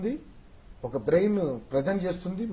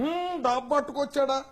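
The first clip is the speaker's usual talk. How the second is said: high, questioning boss impersonation; Rao Gopala Rao; 9 semitones higher than usual. Acted. Boss quizzes his secretary, high-pitched impersonation. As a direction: push the question up high in character. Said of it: "Rao Gopalarao గారు".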